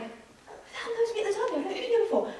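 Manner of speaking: high, quick, puzzled inner voice; mock-bewildered, high and hurried; retold bafflement. Re-enacts baffled thought at derby.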